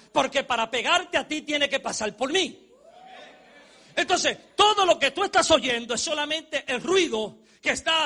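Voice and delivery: preacher on fire, pushing it out; fiery preaching. Acted. Preacher to congregation, urging them to stop crying, loud.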